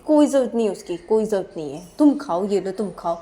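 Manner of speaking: loud, cross brush-off; acted cross refusal. Character refuses partner's offer to feed them, crossly. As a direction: refuse the offer with emphatic force. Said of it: "गुस्सा क्यों हो रही हो?".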